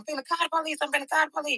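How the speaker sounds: a hint of the anxious mother; frantic mom. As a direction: mom muttering she'll call the police. Mom deciding to call police.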